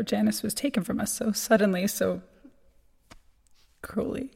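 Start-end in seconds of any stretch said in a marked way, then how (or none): none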